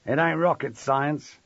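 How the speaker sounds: burly man's rough contempt; snarl. A narrator's reading. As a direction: snarl it as if he's an idiot. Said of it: "a burly man snarled".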